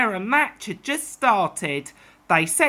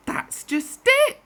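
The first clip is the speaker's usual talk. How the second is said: emphatic reply; 14 semitones higher than usual. Acted. Driver responding.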